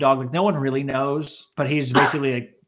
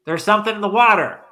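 warning call; 4 semitones higher than usual. Retold or imitated. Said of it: "They were like".

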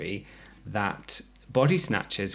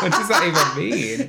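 laughing; 7 semitones higher, 7 dB louder than usual. Real, not acted. Laughing at joke.

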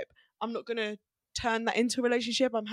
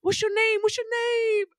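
eager admirer; 8 semitones higher than usual. Retold or imitated. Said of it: "I was like".

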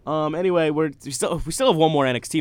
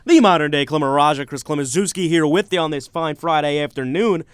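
radio host intro; 4 semitones higher, 6 dB louder than usual. Acted. Radio host welcomes listeners back.

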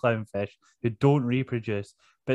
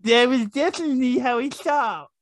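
high, mushy, mangled speech; mouth full of tongues; 8 semitones higher than usual. Real, not acted. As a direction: talk as if your tongue fills your mouth. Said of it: "You sound like your mouth's just full of tongues".

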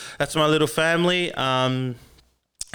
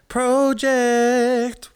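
booming announcer echo on Project; echo effect intro; 10 semitones higher, 5 dB louder than usual. Shown as a demonstration. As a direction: say Project big like a show intro.

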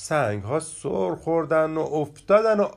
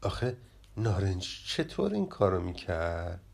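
lowered, softer voice, talking to oneself; muttering under the breath; 6 semitones lower, 7 dB quieter than usual. A narrator's reading. Gush Siah, wondering how Narenji managed it, muttering under the breath. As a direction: grumble it low and soft, as if to yourself. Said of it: "زیر لب گفت".

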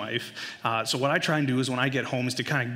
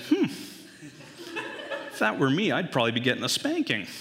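casual son; 3 semitones higher, faster than usual. Acted. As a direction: imitate a boy musing offhandedly. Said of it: "kinda casually just says".

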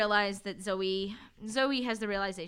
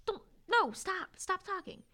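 a lightly hammed plea to stop talking; frantic hushing; 10 semitones higher than usual. [name] hushing the old man, high mock plea.

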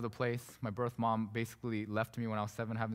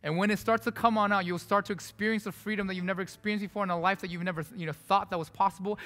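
louder, higher, impassioned sermon call; preaching at full force; 8 semitones higher, 5 dB louder than usual. Acted. Pastor urging the congregation to receive it, fervent preaching. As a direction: drive the appeal home to the church.

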